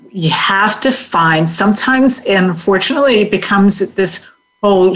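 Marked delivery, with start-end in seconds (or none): none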